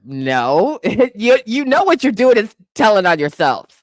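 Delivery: usual speaking voice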